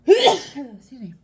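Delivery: loud sudden sneeze, then excuse me; real sneeze bursting out; sneezing. Real, not acted. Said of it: "Sorry, I'm gonna sneeze".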